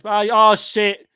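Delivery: ordinary talk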